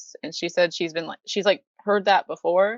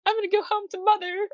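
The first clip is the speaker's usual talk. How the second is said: very high and fast huffy cat voice; a cat storming off in a huff, quick and high; jealous cat; 14 semitones higher, faster than usual. Retold or imitated. The cat threatens to leave Kyo, high huffy voice. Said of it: "and, like".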